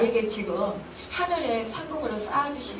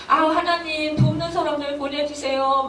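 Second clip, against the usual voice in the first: acted-out plea to God, pitched higher; mock-weary appeal, raised voice; pleading prayer; 6 semitones higher, 3 dB louder than usual. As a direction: beg for help, lightly dramatized. Acted plea to God when overworked.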